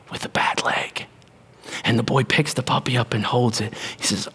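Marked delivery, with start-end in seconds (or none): 0.1-1.1 soft, breathy whisper of the reveal; hushed whisper. Real, not acted. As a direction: drop to a whisper for the sad detail.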